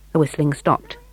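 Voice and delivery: ordinary delivery, nothing marked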